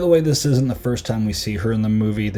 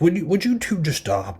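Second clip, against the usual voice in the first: mock exasperated parent; 8 semitones higher than usual. Imitating exasperated parent scolding two girls.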